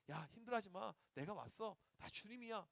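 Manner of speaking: very soft, hushed reassurance; gentle comfort. Acted. Jesus embraces the struggling pastor, softly consoling. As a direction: console softly, as if holding someone.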